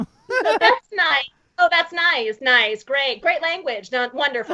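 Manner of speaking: lightly mimicked Midwestern mom. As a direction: repeat polite mom approval with mock niceness. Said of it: "Poor Midwestern mom".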